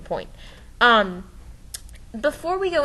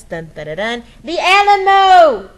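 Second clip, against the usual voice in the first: excited reveal; 5 semitones higher, 11 dB louder than usual. Real, not acted. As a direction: build the suspense and shout the reveal. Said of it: "I just get really excited about the Alamo".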